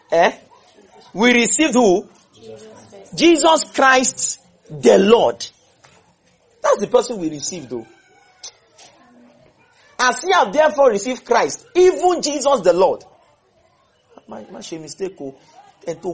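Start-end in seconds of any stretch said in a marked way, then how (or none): 1.1-5.4 loud, high question on "who", then slow "Jesus Christ, the Lord"; emphatic teaching. Shown as a demonstration. Teacher drums the answer into class. 10.0-13.0 high, loud reading peaking on "even Jesus the Lord"; emphatic declamation. Shown as a demonstration. Teacher declaims verse to make point.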